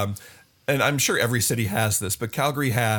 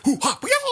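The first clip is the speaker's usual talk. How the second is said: ninja yells; 14 semitones higher, faster than usual. Retold or imitated. Ninja sound effects.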